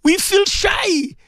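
high, tearful crying out in prayer, breaking into cries; pleading to Allah through real tears, voice cracking high. Real, not acted. Preacher crying out to Allah in dawn dua, tearful. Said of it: "I say this in tears".

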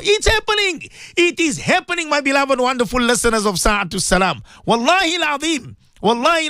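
excited. Real, not acted. Host excitedly greeting radio listeners.

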